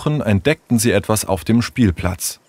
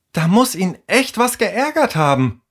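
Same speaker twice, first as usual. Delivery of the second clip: high-pitched, worried character voice; concerned young friend; 10 semitones higher than usual. Acted. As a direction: fret about a missing friend, voice lifted high.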